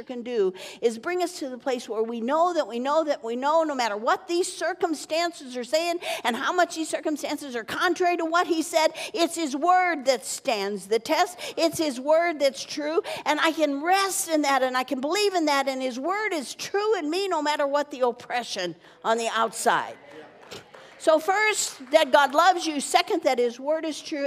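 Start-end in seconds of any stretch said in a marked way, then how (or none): 2.0-20.0 high, louder preaching build; fervent, climbing pulpit cadence. Acted. Preaching at full force. 21.0-24.3 high and louder, ringing out; proclaimed, loud first point; preaching summary. Acted.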